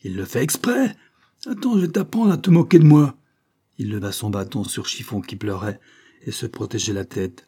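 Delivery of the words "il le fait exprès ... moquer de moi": high threat building louder; furious. A narrator's reading. Banquiste threatens child after collapse, furious. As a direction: erupt in rage at being defied. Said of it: "poussa un cri de fureur".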